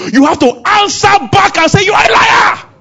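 high, full-force preacher's shout; shouted rebuke. Acted. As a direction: shout it back in defiance. Urging congregation to answer back forcefully.

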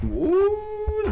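sudden high yelp of surprise; startled ooh. Real, not acted. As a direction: jump at the noise. Mic almost fails, startled.

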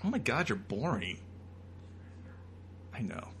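ordinary talk

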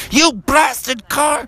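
high, drawn-out mock rage; hammed-up slow furious cry; so mad. Dad curses the car, high mock fury. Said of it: "Froggy's dad was getting so mad".

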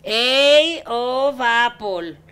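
slow careful spelling. Retold or imitated. Spelling a word to a phone operator. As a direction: spell each letter slowly. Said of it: "Así como".

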